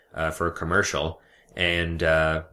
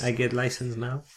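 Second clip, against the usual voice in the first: higher, halting put-on foreign voice; foreign DMV customer; 5 semitones higher than usual. Customer confirming details to a DMV clerk, hesitant foreign voice. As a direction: play a hesitant foreigner at the counter.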